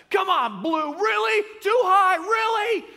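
high, full-volume heckle from the stands; loud, high-pitched sideline yelling; screaming at refs. Acted. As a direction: scream at the umpire like an outraged sports parent. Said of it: "They're screaming at the refs".

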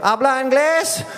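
high, drawn-out call over a river; screamed across. Retold or imitated. Hiker hailing traders across the Rio Grande, drawn-out yell. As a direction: holler slowly to someone far away. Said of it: "I screamed into Mexico".

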